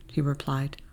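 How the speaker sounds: ordinary delivery, nothing marked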